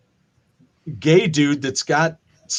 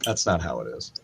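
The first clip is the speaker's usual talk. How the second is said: much lower and softer, muttered protest; low, quiet complaint; grumbling objection; 10 semitones lower, 9 dB quieter than usual. Retold or imitated. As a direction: grumble it under your breath. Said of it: "And you're like".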